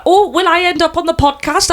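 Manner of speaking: high, eager mock German accent; put-on German accent, pitched high. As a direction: imitate an excited German woman. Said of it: "I'm gonna do the accent".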